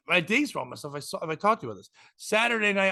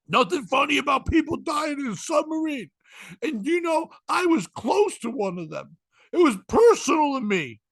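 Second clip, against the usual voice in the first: imitated offended woman, higher and strained; mock wounded complaint, pitched up; upset woman; 9 semitones higher, 3 dB louder than usual. Comic voicing the offended woman at the bar, high and aggrieved. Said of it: "I go".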